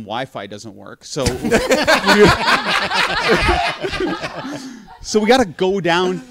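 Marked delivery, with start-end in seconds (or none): 1.3-4.9 laughing hard. Real, not acted. Laughing mid-story.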